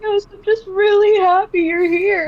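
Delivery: crying fan tells the artist she's glad she came. Retold or imitated. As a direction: sob it out happily.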